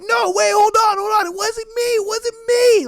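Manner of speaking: high, urgent cries of innocence; mock desperate pleading. Homeboy pleads with security, mock desperate. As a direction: act out a man begging them to stop.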